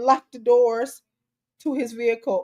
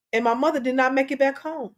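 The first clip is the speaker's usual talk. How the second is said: ordinary talk, nothing marked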